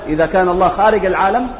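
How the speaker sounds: normal talking voice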